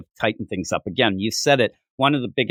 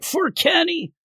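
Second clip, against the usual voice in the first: mock rallying cry; slower than usual. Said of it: "maybe they're like".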